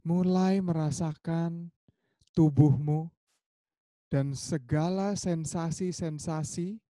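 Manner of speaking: slow meditation voice with drawn-out words; guided meditation. Acted. Teacher guiding friends to feel their bodies, slow guided meditation. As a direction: guide them gradually into body awareness, slow and lifted, with long pauses.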